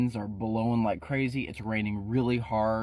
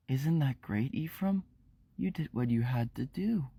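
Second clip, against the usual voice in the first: creepy soft Shelly; 2 semitones higher, 3 dB quieter than usual. Acted. Creepy Shelly comforts Ephraim.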